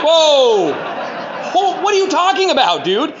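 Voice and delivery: high, loud startled disbelief with a laugh; shocked outburst. Retold or imitated. Hearing brother confess satanic spells, shocked laughing disbelief.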